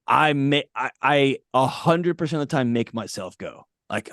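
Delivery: normal talking voice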